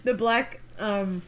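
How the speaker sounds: normal talking voice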